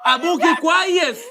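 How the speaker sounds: loud, high, drawn-out reassurance; exasperated, stretched-out yell; insistent shout. Acted. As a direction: shout it slowly over their panic. Drowning out her panic about father.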